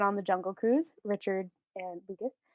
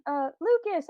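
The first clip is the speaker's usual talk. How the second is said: lightly voiced child, higher; child's voice; 6 semitones higher than usual. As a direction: call out lightly as a kid would. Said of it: "you hear your child laughing, and they're like".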